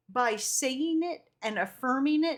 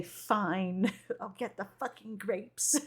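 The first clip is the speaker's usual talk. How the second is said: begrudging; 4 semitones lower, 5 dB quieter, slower than usual. Retold or imitated. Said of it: "I begrudgingly go over to the grapes".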